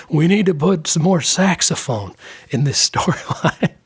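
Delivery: lightly raspy mock Clinton, laughing through it; a hint of a raspy ex-president voice; bad Clinton impression. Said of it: "my bad, raspy voice Clinton".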